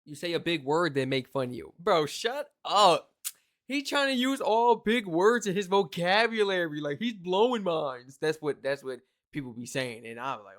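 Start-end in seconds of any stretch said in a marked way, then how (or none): 1.8-8.2 slow, loud, high-pitched mockery; mocking imitation. Imitating people making fun of big-word users, loud drawn-out mockery. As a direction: drag out the jeer, imitating scoffers.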